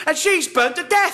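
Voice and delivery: normal talking voice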